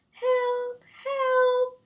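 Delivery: high, thin, distant shriek; tiny faraway shriek. Acted. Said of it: "shrieked the tiny faraway voice".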